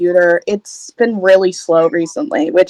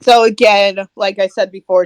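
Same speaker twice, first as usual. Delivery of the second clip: normal talking voice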